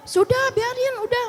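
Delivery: high-pitched imitation of an impatient husband; lightly acted brushing-off of a child; retold dismissal.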